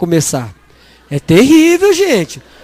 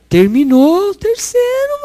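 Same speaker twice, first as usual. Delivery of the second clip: excited announcement; 8 semitones higher than usual. Retold or imitated. Excited report of graduation.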